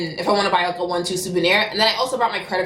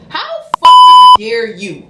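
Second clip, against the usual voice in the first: slow indignant outburst; 14 dB louder, much slower than usual. Retold or imitated. Repeats indignant question about the theft.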